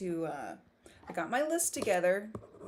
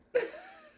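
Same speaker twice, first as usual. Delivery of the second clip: squeaky laugh. Real, not acted.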